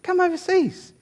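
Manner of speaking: high, cooing call; high mock girlfriend voice; high-voiced girlfriend. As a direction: coax him over sweetly. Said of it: "she said".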